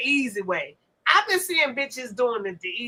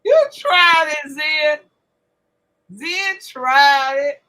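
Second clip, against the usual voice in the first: teasing call-out; 3 semitones higher, 7 dB louder, much slower than usual. Real, not acted. Teasing friend who laughed.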